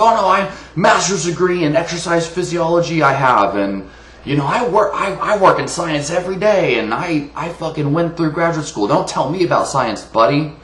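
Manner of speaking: mock indignant boasting. Ranter mimicking gym guy flaunting his degree, mocking. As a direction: parody a puffed-up know-it-all defending his credentials.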